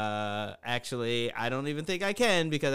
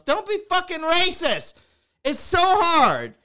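much higher and louder outburst; exasperated rant; 11 semitones higher, 6 dB louder than usual. Real, not acted. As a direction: let the frustration burst out high. Ranting about racist drivers.

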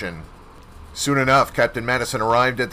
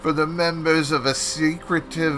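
put-on slow secretive old voice; hushed old professor; 2 semitones higher, slower than usual. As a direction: tell the secret like an old storyteller. Professor tells of the cult.

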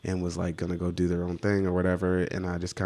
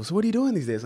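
imitated aunt in a high voice; high-pitched retold small-talk question; nosy-question aunt; 12 semitones higher, faster than usual. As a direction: play an aunt asking, pitched way up. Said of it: "when my aunt, like, asked me".

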